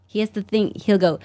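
ordinary talk, nothing marked

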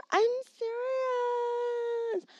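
high, slow warbling insistence; mock eerie. Insisting to listeners, hammed-up eerie. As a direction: draw it out like a ghost.

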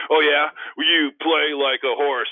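loud, high, slow comeback; big hammed-up retort; retold trash-talk comeback. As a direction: fire back a clumsy taunt with full volume. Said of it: "I am a horrible trash talker".

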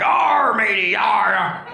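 high, loud pirate growl fading. Retold or imitated. Pirates agree to defend the nation, hammed-up pirate arr. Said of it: "the pirates are like".

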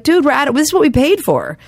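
high-pitched indignant heckling; mock yell at the band; imitated yelling. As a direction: act out an outraged concertgoer complaining. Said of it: "he got up and started yelling".

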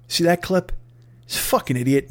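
ordinary talk, nothing marked

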